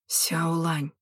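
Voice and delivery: quiet voice. A narrator's reading. Unseen man calls to the girl from behind, quietly. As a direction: call the name low, like rustling pages. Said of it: "прозвучал тихий голос, похожий на шелест страниц".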